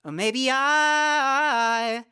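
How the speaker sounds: I sung slowly with an open vowel; wide open I. Shown as a demonstration. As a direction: sing I slowly with an open jaw. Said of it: "So you're not going".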